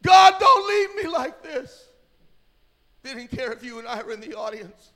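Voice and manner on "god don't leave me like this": high, loud, drawn-out wail; imitating a desperate, slow cry; wailing plea. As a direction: wail it out to God. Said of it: "they just wailed out".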